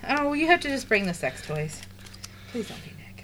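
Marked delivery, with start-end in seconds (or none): 2.5-3.2 unvoiced whispered plea; whispering. Real, not acted. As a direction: whisper a quick hope.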